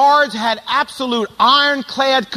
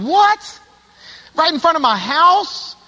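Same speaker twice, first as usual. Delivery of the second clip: a sudden high What, then a slower stunned question; shocked disbelief; 6 semitones higher, slower than usual. Retold or imitated. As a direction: react like you can't believe it happened at your own house. Hears car was broken into.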